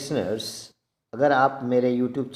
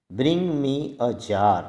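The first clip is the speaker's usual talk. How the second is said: slow model sentence; much slower than usual. Shown as a demonstration. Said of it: "model sentence for learners".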